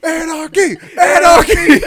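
high yell, building to a full shout; hammed-up rebel scream; anarchist war cry. Imagined kid's rampage.

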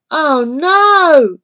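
put-on high, loud alarmed cry of a scared boy; boy's sudden panic at being caught; frightened gasp. A narrator's reading. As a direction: blurt it out in fright. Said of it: "he gasped".

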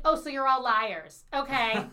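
loud, scornful accusation; accusing. Retold or imitated. Woman confronting the man's friends about their jobs, loud accusing. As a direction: call them out loudly.

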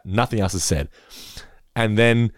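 ordinary talk, nothing marked

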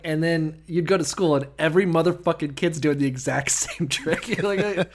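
ordinary delivery

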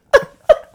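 high shrieking laugh burst; hysterical laugh. Real, not acted. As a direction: squeal with laughter. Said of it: "Why are you laughing like that?".

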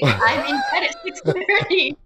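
high, slow mock disgust through laughter; laughing self-mockery. Actress mocking her own early bedtime, laughing disgust.

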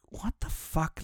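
unvoiced, breathy what-the-hell under the breath; stunned whisper to yourself; whispered disbelief. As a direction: mutter it to yourself, baffled. Inner thought, bewildered.

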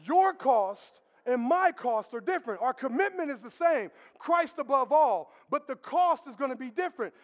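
loud, slow preacher's emphasis; rising sermon cadence; full-force preaching. Real, not acted.

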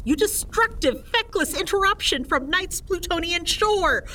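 high, theatrical rebuke; indignant scolding. Acted. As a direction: scold the little dragon grandly. Scolding the fire-spitting dragon.

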